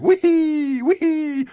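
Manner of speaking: high voice. Retold or imitated. Host mimics schoolgirls on a turbulent flight in a squeaky high voice. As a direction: squeak it like middle school girls on a bumpy flight. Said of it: "How'd you like my high voice".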